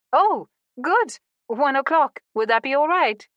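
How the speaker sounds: delighted. Acted. Saskia delighted at acceptance.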